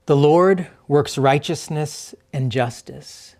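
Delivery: very unhurried reading with long silences between lines; slow scripture reading. Real, not acted. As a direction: read slowly, leaving room to listen. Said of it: "as I read through it slowly again".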